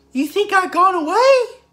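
very high, loud cartoon mouse falsetto; Mickey Mouse. Proving the impression never left, in a high mouse voice. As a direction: pitch it way up like a squeaky cartoon mouse. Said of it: "like Mickey Mouse".

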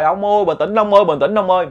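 high, loud pleading to calm him; pleading shout. Retold or imitated. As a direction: shout to calm her husband. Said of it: "bà la quá trời".

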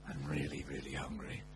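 low, dragging moan of hunger; whiny hungry complaint. Retold or imitated. To his cousin, a low mock-miserable moan. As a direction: groan it out like a starving man.

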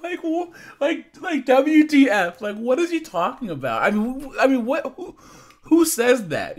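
high, disbelieving outburst, rising in pitch; can't believe what he's reading; incredulous. Real, not acted. Vlogger reacting to a creep's message, high and incredulous.

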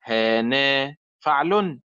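هانا فعل said slowly and evenly; slow demo. Shown as a demonstration. Teacher slowly marking the stressed syllable in هانا. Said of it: "سنجد أن وسط الكلمة".

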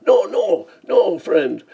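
high, loud panicked cry; frantic, high-pitched begging; screamed plea. A narrator's reading. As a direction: scream in terror to stop him. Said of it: "they screamed".

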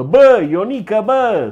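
loud, high call of Ionică's name; mock shout. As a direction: bellow it like an alarm going off. (strigăt în glumă)

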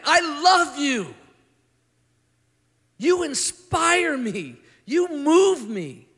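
high, loud and slow declaration; loving, fervent. Retold or imitated. Jesus telling the young man he loves him, high and slow. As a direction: pour out your love slowly. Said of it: "And Jesus looks and goes".

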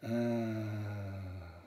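"Ah." let out on an open-mouthed breath; sighed ah. Shown as a demonstration. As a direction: breathe the "Ah." out as a sigh. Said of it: "exhale deeply through an open mouth and say".

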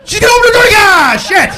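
very high, loud panicked shout; panicked yell. Retold or imitated. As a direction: scream a warning in panic. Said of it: "What could I yell".

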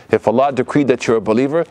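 ordinary delivery

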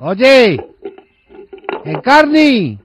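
ordinary talk

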